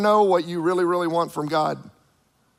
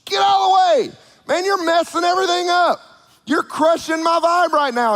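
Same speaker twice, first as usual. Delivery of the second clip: annoyed crowd; 10 semitones higher than usual. Retold or imitated. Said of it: "before it was like".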